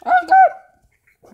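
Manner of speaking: high frightened gasp of words; shocked and startled; scared exclamation. Real, not acted. Jump scare in horror game.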